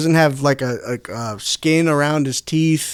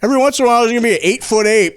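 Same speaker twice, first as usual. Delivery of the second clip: ordinary delivery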